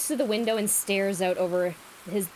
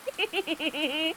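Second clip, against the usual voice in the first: high giggle; 7 semitones higher, 3 dB quieter than usual. Real, not acted. Reaction laugh.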